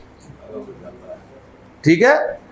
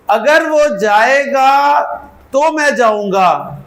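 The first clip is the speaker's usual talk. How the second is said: slow dictation; 4 semitones higher, slower than usual. Shown as a demonstration. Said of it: "इसकी अंग्रेजी कर दें".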